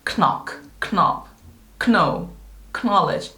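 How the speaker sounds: "knock, knob, know, knowledge" each with a K before the N; old-style K-N onset in "knock, knob, know, knowledge"; sounded K. Shown as a demonstration. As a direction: say k-nock, k-nob, k-now, k-nowledge, one at a time. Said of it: "K와 N 둘 다 발음이 됐었다고".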